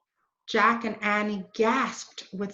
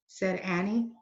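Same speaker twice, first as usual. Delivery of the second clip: ordinary delivery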